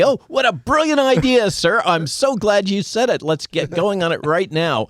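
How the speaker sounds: high, loud fawning flattery; obsequious. Retold or imitated. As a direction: gush like an eager yes-man. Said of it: "You're surrounded by sycophants".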